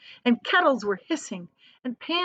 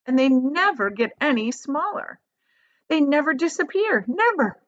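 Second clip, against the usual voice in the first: rising, louder insistence on never; building boast; 6 semitones higher, 3 dB louder than usual. Acted.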